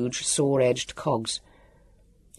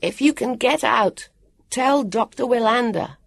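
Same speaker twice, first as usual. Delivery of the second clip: higher, measured calling voice; clear, raised-pitch call; 9 semitones higher than usual. A narrator's reading. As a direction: call instructions across a distance. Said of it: "Jonathan called".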